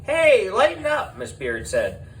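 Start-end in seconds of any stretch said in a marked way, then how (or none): 0.0-1.2 high, brash showbiz producer voice; high, loud and pushy, a producer talking teachers into drama. A narrator's reading. Miss Beard character voice.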